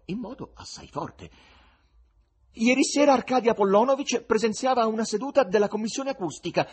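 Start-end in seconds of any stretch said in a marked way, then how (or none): 2.6-6.7 loud, high-pitched haughty declaration. A narrator's reading. Wife answering for Arkadia Apollonovich, very haughty. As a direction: announce it imperiously, voice raised high. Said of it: "dichiarò con fare molto altero".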